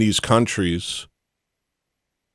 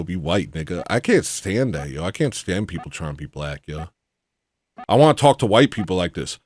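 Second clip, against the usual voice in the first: ordinary talk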